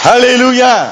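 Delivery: high-pitched Hallelujah call to the congregation; rallying acclamation. Real, not acted. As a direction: call it out high and sustained for all to answer.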